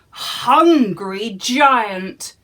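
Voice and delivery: slow, loud hungry giant; drawn out. A narrator's reading. Narrator building up the scary giant, slow and loud.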